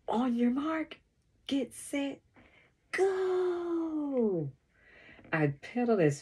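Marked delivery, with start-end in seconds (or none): 0.1-4.5 high-pitched starting call, 'go' stretched out; shouted race start. A narrator's reading. Kiki starting the bike race, shouting. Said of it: "Kiki shouts".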